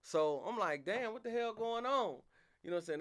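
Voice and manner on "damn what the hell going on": high, loud exasperated outburst; exasperated yell. Retold or imitated. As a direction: throw up your hands and yell. Outraged at being purged.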